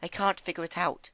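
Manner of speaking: high, gentle pony voice; high timid pony. Acted. Fluttershy voicing Rarity.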